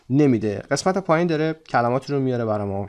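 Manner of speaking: normal talking voice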